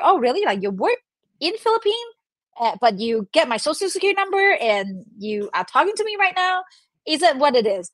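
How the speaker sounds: high-pitched, rising disbelieving questions; mock-astonished cross-checking; acted incredulity. Acted questioning of a call agent.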